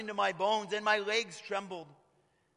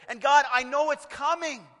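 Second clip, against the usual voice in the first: louder, higher plea to God; earnest cry; 4 semitones higher, 8 dB louder than usual. Pretend. Habakkuk telling God he will wait, loud and earnest. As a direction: cry it out in resolve. Voicing Habakkuk's resigned prayer.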